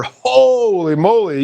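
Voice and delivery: ordinary delivery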